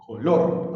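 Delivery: "Color" with a loud punch on "lor"; stress on last syllable. Shown as a demonstration. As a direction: lean hard on the second syllable of "Color". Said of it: "Ubiquemos el acento".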